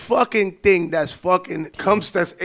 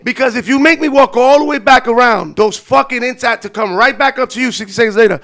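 louder, higher furious rant; angry rant; 5 semitones higher, 8 dB louder than usual. Retold or imitated. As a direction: rant with rising fury. Escalating retold rant.

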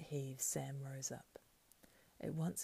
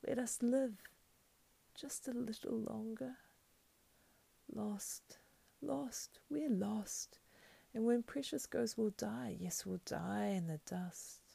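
high, wailing creature voice; whimpering; 7 semitones higher, slower than usual. A narrator's reading. Creature begging Sam for a little more life, whimpering. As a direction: wail in despair, high and pitiful. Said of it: "fell flat on the ground and whimpered".

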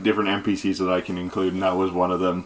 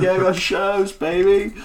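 swagger it like a washed-up rock star; laid-back bard introducing himself, cocky; 11 semitones higher, 3 dB louder than usual. Acted. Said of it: "He's like".

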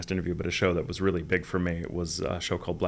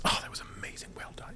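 mock effusive praise; 5 dB quieter, faster than usual. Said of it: "you're not gonna get this".

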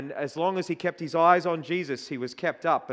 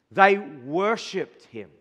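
slow scripture reading; 4 semitones higher, 5 dB louder, slower than usual. Acted. Reading verse slowly, emphatic.

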